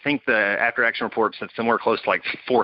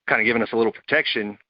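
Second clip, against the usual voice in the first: ordinary delivery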